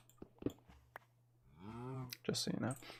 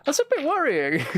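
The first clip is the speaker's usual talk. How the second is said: much higher, nervous squeak; mock worried squeak; 13 semitones higher, 3 dB louder than usual. Streamer spooked by a chat message, high nervous squeak. Paranoid about a viewer's message.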